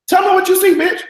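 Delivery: shrieked way up high and loud; hammed-up squeal of a demand; high loud mock demand. Hosting her viewers, she shrieks the line way up high. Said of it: "just like Claire said".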